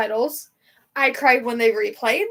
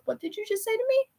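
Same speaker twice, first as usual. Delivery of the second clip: high, fast and softer disbelief; shocked squeak; 10 semitones higher, 5 dB quieter, faster than usual. Retold or imitated. Fan reacting to dad's news, high stunned squeak. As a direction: shriek it in a hushed, stunned rush.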